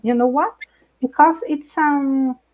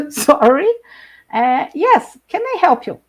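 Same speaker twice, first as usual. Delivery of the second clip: louder, higher puzzled reply; confused neighbor; 4 semitones higher, 6 dB louder than usual. Retold or imitated. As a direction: play the neighbor who doesn't understand. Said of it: "And the people".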